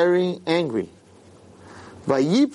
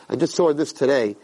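normal talking voice

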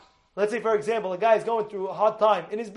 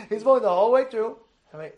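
ordinary talk

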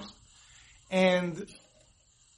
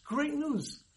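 excited; 8 semitones higher, much slower than usual. Retold or imitated. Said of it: "he sounded very excited".

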